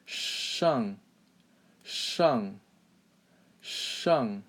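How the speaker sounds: slow "shang" with a long retroflex sh; careful model "shang", hushed sh; curled-tongue shang. Shown as a demonstration. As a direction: curl the tongue back for "shang", falling tone. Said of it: "curl your tongue and say".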